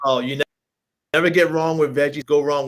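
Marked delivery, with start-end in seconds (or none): none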